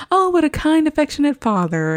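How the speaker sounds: high, sweet gushing teacher voice; host voices the teacher praising Sully, high and gushing; teacher's voice. Retold or imitated. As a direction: coo it like a charmed daycare lady. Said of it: "the person who's watching the kids is like".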